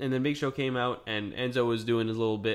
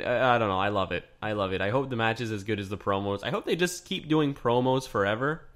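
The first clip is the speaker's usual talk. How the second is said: ordinary talk, nothing marked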